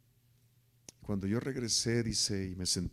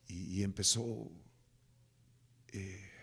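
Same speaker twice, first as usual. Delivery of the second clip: ordinary delivery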